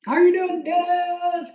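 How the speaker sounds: high, loud hello shouted to a viewer; loud greeting. Real, not acted. Host greeting Dennis on the live chat, loud and high. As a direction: call out a big hello.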